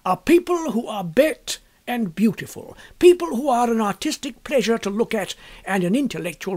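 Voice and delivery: lightly voiced painter. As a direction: pronounce it like a self-assured bohemian painter. Painter Trevor holding forth.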